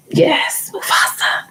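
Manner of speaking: breathy whisper; excited hushed whisper; whispered shout. Real, not acted. Hushed playful chat reaction.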